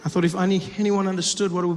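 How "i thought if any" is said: normal talking voice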